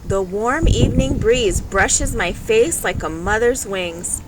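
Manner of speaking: ordinary delivery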